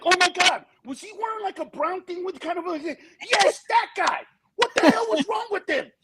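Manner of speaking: very high, disbelieving gossip; incredulous comedian. Retold or imitated. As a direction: squeal in shocked disbelief about the guy. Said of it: "the other comedians will be like".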